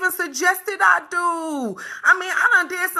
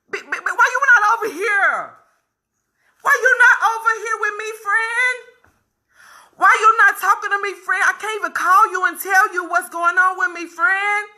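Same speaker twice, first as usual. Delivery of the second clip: pleading friend; 6 semitones higher than usual. Real, not acted.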